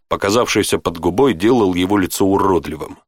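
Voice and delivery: usual speaking voice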